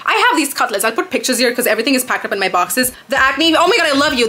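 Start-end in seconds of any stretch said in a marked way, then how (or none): 3.1-4.3 higher, louder gushing thanks; excited gush. Real, not acted. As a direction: burst out in delighted gratitude.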